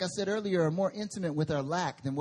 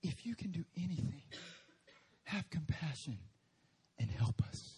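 hushed, softer begging plea, fading to a breath; pleading father; 7 dB quieter than usual. Acted. Father pleading with Jesus, hushed and desperate. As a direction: plead quietly and helplessly. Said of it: "listen to his f-, plea".

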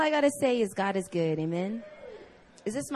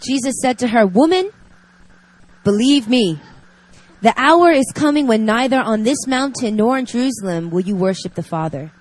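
reading scripture; 4 dB louder than usual. Acted. Reads scripture passage aloud.

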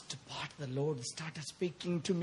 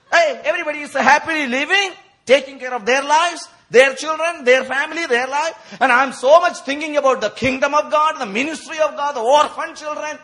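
high, loud, impassioned sermon delivery; full-force preaching; 9 semitones higher, 9 dB louder than usual. Acted. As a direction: preach it out to the whole congregation.

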